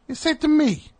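very high squeaky pet-talk; dog voice. Retold or imitated. Annoyed owner answering the groomer, in squeaky mock dog voice. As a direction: keep the squeal on every word.

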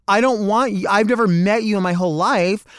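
usual speaking voice

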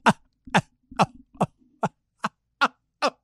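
clipped staccato fake ha-ha; forced laugh in separate bursts, ending in a gasp; hollow mechanical laughing. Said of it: "look at you and go".

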